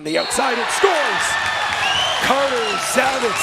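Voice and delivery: rising to a big shout; excited goal call. Real, not acted.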